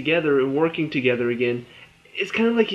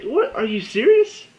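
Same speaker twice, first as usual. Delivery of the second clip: much higher, louder, what are you serious; stunned disbelief; 9 semitones higher, 3 dB louder than usual. Retold or imitated. Vlogger reacting to Kara forgiving Alex, stunned. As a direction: jaw dropped at the screen. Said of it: "I'm like".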